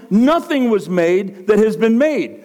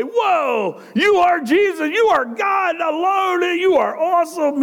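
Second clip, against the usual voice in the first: high, amazed crowd voice, near shouting; pitched-up, wide-eyed praise; awed worship; 8 semitones higher than usual. Pretend. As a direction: gush like a stunned crowd that just saw a miracle.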